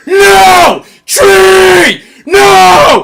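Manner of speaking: very loud, high-pitched screamed protest; imitated toddler scream. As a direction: scream it out like a stubborn toddler, drawing each word out. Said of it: "he just screamed".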